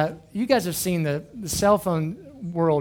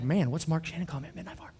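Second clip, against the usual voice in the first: mumbled, soft and fast, just woken up; a half-asleep grumble down the phone; sleepy annoyed callee; groggy mumble; 8 dB quieter, faster than usual. Retold or imitated. Sleepy callee voiced in story.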